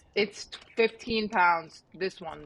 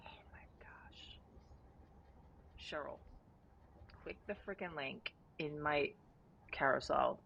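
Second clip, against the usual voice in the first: hushed; 3 semitones lower, 11 dB quieter than usual. Real, not acted. Quiet mouth-full plug.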